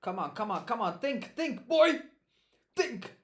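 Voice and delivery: loud, high shout, climbing to a yell; urging himself on. Real, not acted. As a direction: yell at yourself to think, pitch rising. Urging himself to think while studying.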